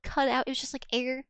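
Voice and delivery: ordinary delivery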